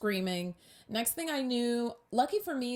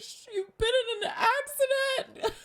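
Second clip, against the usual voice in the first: very high, strained: "accident" squeaked; panicked squeak; 3 dB louder than usual. Retold or imitated. First try telling the coworker, panicked squeak. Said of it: "I'm not good in a crisis".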